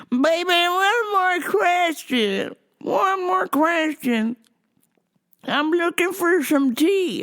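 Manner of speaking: high, slow sweet granny voice; old granny voice. Retold or imitated. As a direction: drag it out like a stoned old lady. Voicing Weed Granny asking questions.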